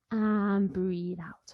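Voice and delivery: slowed, drawn-out breathe out; slow breathing out. Shown as a demonstration. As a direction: stretch the words like a long breath. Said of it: "breathe in".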